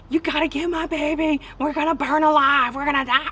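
high, loud, frantic pleading; a terrified mother begging for her baby; panicked mom. Retold or imitated. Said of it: "she was in shock, spazzing the fuck out".

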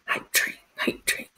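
soft unvoiced chant; whispering. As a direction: whisper it like a secret. Whispering hype train chant.

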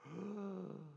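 soft, drawn breath in; awestruck gasp. Acted. Someone hears "Hey, John", soft awed gasp. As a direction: gasp softly as if recognized. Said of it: "Gollum hears his name".

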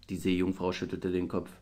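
ordinary delivery, nothing marked

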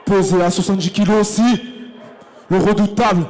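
big arena announcer voice; boxing ring announcer. Acted. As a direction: call it out to the whole hall. Ring announcer introduces fighters.

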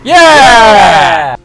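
loud, high, drawn-out yell; excited cheer. Real, not acted. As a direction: whoop in triumph. Cheering a game success.

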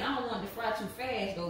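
normal talking voice

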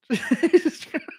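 high, slow words dissolving into laughter; uncontrollable laughter. Real, not acted. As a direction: gasp it out while cracking up. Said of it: "I don't know why I'm laughing so much".

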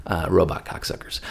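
whispered aside. Retold or imitated. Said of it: "the Saudis are like".

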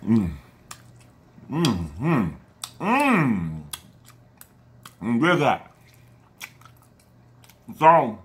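chewing between words; biting into wing; mouth full of chicken. Real, not acted. Seen 2: Lips pursed, chewing between bites; bites wing again at 2.1s.

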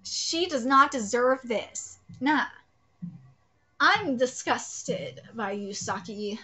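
ordinary delivery

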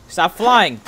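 normal talking voice

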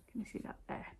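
very soft unvoiced whisper; hushed whisper asking viewers if they can see; whispered question. Real, not acted. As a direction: whisper it so nobody else hears. Hushed aside to camera.

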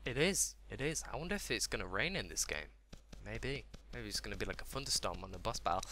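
usual speaking voice

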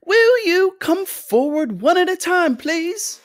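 very high, fluty host calling out; fussy, high-pitched ringmaster. A narrator's reading. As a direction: call them forward in a high, piping voice. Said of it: "His voice was high and fluty".

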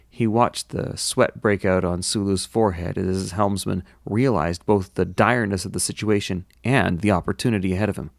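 usual speaking voice

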